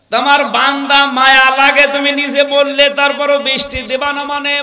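high, loud indignant plea; retold angry protest. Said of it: "মুসা নবী রাগী নবী ছিলেন".

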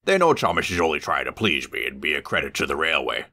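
usual speaking voice

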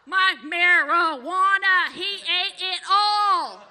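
slow, loud, drawn-out outrage; furious. Retold or imitated. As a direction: drag out each word in disbelief. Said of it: "my brother was furious".

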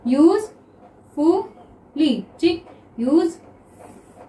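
Usefully stretched out slowly; slow drawn-out reading. Shown as a demonstration. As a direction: draw out usefully for students. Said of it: "next देखिए usefully". (Usefully को धीरे-धीरे)

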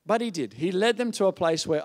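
ordinary talk

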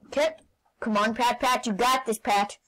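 ordinary talk

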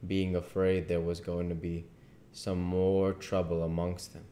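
plain reading voice

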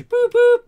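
high, very loud "boop boop" toot; loud mock hooter. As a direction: toot like a station master's train. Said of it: "God, that echoed".

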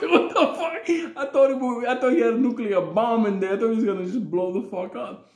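high, loud, laughing panic; telling it half laughing, half scared; laughing disbelief. Real, not acted. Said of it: "I was like".

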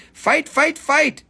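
high, punched mock chant; egging on a fight. Seller goads buyers over a stone, high playful chant.

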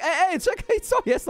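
high-pitched calling after someone; high, startled "hey, wait"; startled protest. Acted. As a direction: jump in to stop a runaway child. Calls after son running off.

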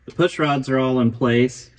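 usual speaking voice

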